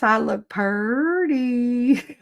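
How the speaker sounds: very slow, stretched-out PURTY; playful drawl. Pretend. Streamer admiring her own green dress, playful slow drawl.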